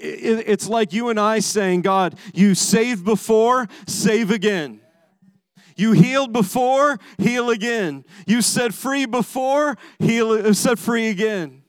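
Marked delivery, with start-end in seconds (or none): 1.8-11.6 higher, forceful prayer cry; impassioned plea to God. Acted. Preacher voicing the congregation asking God to act again, pleading. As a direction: call out each plea with rising insistence.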